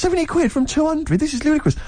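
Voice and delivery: as if the offer is absurd; mock outrage at the price; incredulous.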